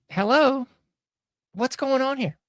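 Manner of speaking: high-pitched, incredulous call-out; mock incredulous. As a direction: ask as if nobody is noticing. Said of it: "This is just me being".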